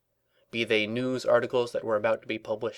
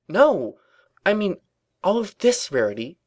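high, loud, exasperated correction; agitated outburst; 9 semitones higher, 6 dB louder than usual. A narrator's reading. Twilight sets Rarity straight, agitated and loud. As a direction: burst out in frustration.

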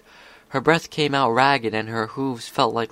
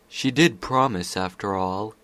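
plain reading voice

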